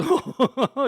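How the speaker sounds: high, loud laughing tease; retold gleeful laugh. Dave enjoys keeping the secret, gleeful. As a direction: imitate a friend laughing knowingly.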